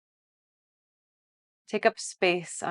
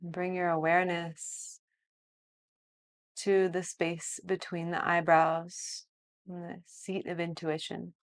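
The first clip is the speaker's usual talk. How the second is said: softer, slow body-scan guidance; guided meditation; 8 dB quieter than usual. Acted. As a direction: lead a calm body scan.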